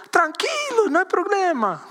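high, easygoing islander impression in Spanish; imitated islander. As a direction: play an unbothered islander, pitched well up. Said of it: "You know what islanders say?".

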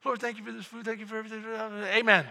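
mock rushed mealtime prayer; hurried hungry grace. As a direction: rattle off grace, landing hard on amen. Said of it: "some of you get hungry like".

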